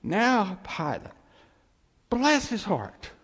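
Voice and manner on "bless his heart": a light Southern drawl on "bless his heart"; "bless his heart" in a mild Southern lilt. Retold or imitated. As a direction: lightly play it like a Southern auntie. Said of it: "Come, down south".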